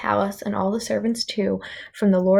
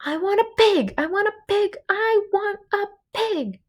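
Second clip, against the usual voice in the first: tantrum; 12 semitones higher than usual. Acted. Princess tantrum, repeated demand.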